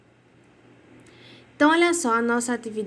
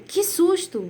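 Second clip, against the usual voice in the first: startled exclamation; 7 semitones higher, slower than usual. Shown as a demonstration. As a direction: say it like something just jumped out. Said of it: "como se tivesse realmente um tom de susto".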